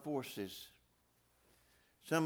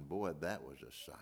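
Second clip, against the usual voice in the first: awed whisper; 8 semitones lower, 9 dB quieter than usual. Real, not acted. Hushed awe at the sight.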